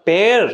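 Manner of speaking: Pair stretched out with emphasis; teacher's demo of a long, stressed pair; stressed, drawn out. Shown as a demonstration. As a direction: lean on pair and draw out its vowel. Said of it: "তখন আমাদেরকে জোর দিতে হচ্ছে".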